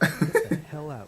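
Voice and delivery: slow, dragged-out get out; mock annoyed king. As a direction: drawl the dismissal. King throws out servant.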